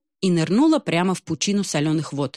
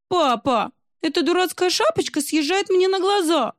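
high whiny mock whimper; whining; 13 semitones higher than usual. Русалка хнычет отцу про медузу на голове, высоко и капризно. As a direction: whimper it high like a fussy child. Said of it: "захныкала русалка".

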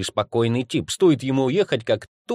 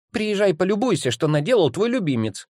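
high-pitched, exasperated call; mother's outcry; 8 semitones higher than usual. A narrator's reading. Mother phoning the aunt about her favourite, high-pitched outcry. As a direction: complain shrilly, pitched well up, without full shouting. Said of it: "кричала мама".

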